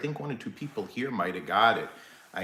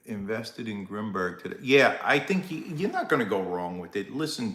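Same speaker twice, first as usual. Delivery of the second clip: ordinary delivery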